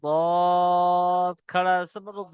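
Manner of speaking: সা and শা held long, very slow; slow recitation drill. Shown as a demonstration. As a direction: intone each syllable slowly. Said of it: "পড়তে থাকবেন আমার সাথে সাথে".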